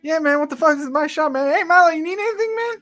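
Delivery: brash shopkeeper voice. Acted. Sean boasting to Milo about his shop, high and brash. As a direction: claim your shop with swagger. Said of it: "Sean goes".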